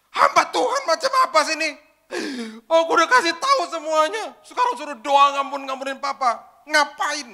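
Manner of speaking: high, loud raging shout; furious yelling. Retold or imitated. Girl jumps up and screams at the pastor in his home, enraged. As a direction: stand up and scream at him. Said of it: "Oh, ngamuk, ngamuk".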